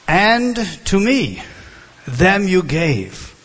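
"them" hit hard and drawn out, high and loud; slow, forceful reading that lands on "them"; stress on THEM. Shown as a demonstration. Preacher reading scripture to the congregation, heavy stress on "them". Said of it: "the emphasis is on them".

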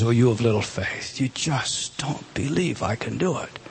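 soft, low, tender chiding; gentle rebuke. Acted. Jesus gently rebuking his disciples, soft and low. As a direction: chide them softly, almost hushed.

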